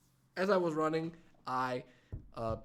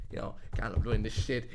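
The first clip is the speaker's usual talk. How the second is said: ordinary delivery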